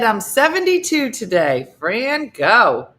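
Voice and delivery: big rousing shout-out; cheering someone on. Real, not acted. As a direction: whoop it up for a viewer's birthday. Cheers a viewer's birthday announcement.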